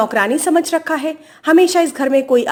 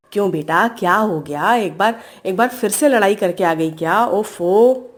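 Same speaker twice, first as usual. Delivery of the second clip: low motherly voice, gently weary; concerned, exasperated mother; 6 semitones lower than usual. Acted. Mother asks her daughter about another fight, concerned and exasperated. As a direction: pitch down and ask with a sigh of dismay.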